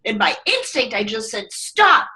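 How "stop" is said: high sharp call of stop; sudden retold stop. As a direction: snap a quick stop at a stranger. Bumped into on the street.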